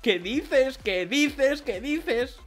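high, repeated stunned questions; real amazement. Real, not acted. To viewers about the pulled card, high stunned amazement. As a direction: keep asking it, unable to believe it. Still shocked by the pull.

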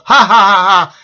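mock a demon's gloating cackle in separate beats; demonic laugh. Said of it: "He's laughing in this demonic voice".

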